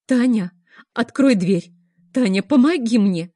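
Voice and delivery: loud, desperate calls for help; frantic pleading. A narrator's reading. Woman begs her friend Tanya for help, loud. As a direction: beg her friend in hysterics. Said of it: "лепетала я".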